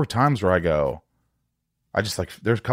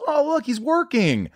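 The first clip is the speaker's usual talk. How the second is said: much higher, slow mock awe; mock impressed. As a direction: gush like an impressed onlooker. Imitating onlookers' praise.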